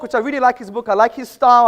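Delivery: ordinary talk, nothing marked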